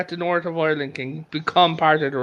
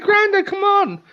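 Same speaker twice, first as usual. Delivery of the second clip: put-on much higher, exasperated girl's voice; granddaughter's voice. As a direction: coax him, pitched up high. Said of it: "She's like".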